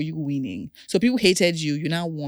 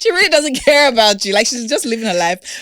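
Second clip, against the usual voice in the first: high, loud, gleeful ribbing; playful teasing; 8 semitones higher, 10 dB louder than usual. Real, not acted. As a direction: rib her in a high, loud voice. Teases guest after laughing.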